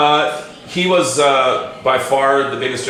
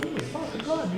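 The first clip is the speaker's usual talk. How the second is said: high, hushed awestruck exclamation; 12 dB quieter, much faster than usual. Retold or imitated. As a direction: marvel quietly in disbelief. Awed backstage reaction retold.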